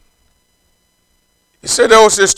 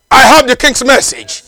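bold messenger; 6 semitones higher, 7 dB louder than usual. Acted. Cockney answers the gate.

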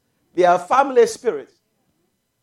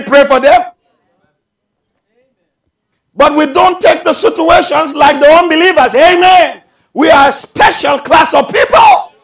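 raised, forceful sermon voice; full-force preaching; 4 semitones higher, 9 dB louder than usual. Real, not acted. Preacher urging believers to pray and not look back, full-force preaching. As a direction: call it out from the pulpit.

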